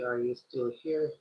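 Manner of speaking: ordinary talk, nothing marked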